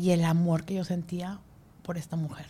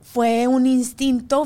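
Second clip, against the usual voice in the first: emocionada; 6 semitones higher, 8 dB louder, much slower than usual. Real, not acted. Emoción al recordar.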